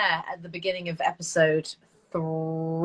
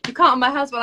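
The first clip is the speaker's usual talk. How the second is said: usual speaking voice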